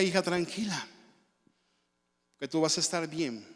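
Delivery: hushed, gentle reassurance to a daughter; soft comforting. Retold or imitated. Father soothing his crying teenage daughter, softly. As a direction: soothe her quietly like a father.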